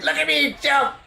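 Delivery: very high, gleeful gnome voice; excited gnome Toby. Retold or imitated. Gnome begging friends to watch his jumps, gleeful. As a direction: imitate a little show-off, squeaking with glee. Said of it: "He's a gnome".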